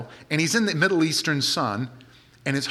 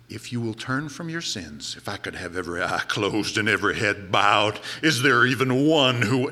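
mock altar call; 6 semitones lower than usual.

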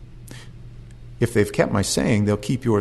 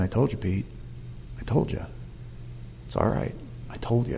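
hushed reassurance; 2 semitones lower, 14 dB quieter than usual. Retold or imitated. Jesus's look to Peter after the denial, hushed reassurance. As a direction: reassure him gently, barely voiced.

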